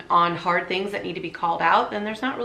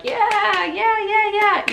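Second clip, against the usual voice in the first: high, eager mock agreement; flattering yes-man; 13 semitones higher, 3 dB louder, slower than usual. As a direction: gush a fake yeah, yeah, yeah. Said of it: "if people are like".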